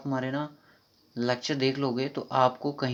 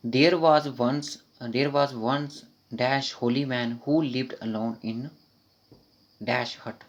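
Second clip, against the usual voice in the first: slow reading aloud; 3 dB louder, much slower than usual. Shown as a demonstration. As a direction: read very slowly, word by word. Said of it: "देखिए next question".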